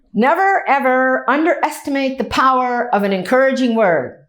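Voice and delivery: retelling a famous one-line commencement speech, stressing never; emphatic quote. Retold or imitated. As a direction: pounce on never, then lay it down firmly.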